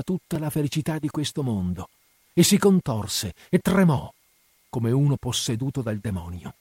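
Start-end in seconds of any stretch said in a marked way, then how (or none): none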